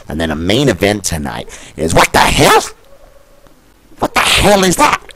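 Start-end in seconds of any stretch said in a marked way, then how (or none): 1.9-2.6 high, loud cry of disbelief; sudden squeak of surprise; shocked outburst. Acted. Commentator shocked by match. 4.0-5.0 higher, disbelieving question; can't believe what he reads; high incredulous ask; shocked. Acted. Commentator shocked by match.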